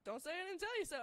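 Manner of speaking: told-you-so. Retold or imitated. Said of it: "And he's like".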